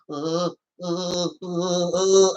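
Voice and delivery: imitated frightened shuddering noises; low, wordless mock-scared stutter; scared whimpering. Imitating Luigi scared of ghosts, mock frightened shudder. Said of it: "Why are you still scared?".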